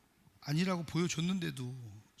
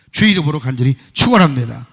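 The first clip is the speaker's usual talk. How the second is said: blessing proclaimed much louder, lower; fervent blessing; 7 semitones lower, 16 dB louder than usual. Real, not acted. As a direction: preach the blessing with full force. Closing blessing shouted. (간절한 축원, 힘차게 크게)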